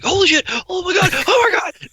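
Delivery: high, loud freak-out, building with each exclamation; acted frantic excitement. Imitating some guy next to me who knows deer, frantic excitement. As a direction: play someone losing it, pitch climbing. Imagined guide panicking at deer.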